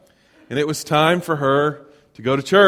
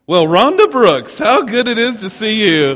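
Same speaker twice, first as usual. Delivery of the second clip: hearty greeting; 6 semitones higher, 5 dB louder than usual. Retold or imitated. Voice from behind greets Rhonda.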